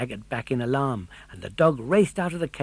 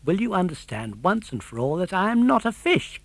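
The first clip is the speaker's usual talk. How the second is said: higher, fed-up puppet protesting; exasperated cry; 6 semitones higher than usual. A narrator's reading. Puppet insisting to the fisherman he is no fish, exasperated. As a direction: make him finally get it, exasperated. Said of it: "cried Pinocchio in exasperation".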